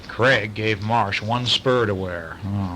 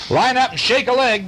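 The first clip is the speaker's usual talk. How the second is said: calling out; 13 semitones higher, 5 dB louder than usual. A narrator's reading. Scout leader calling out.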